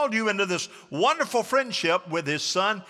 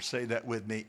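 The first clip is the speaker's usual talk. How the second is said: usual speaking voice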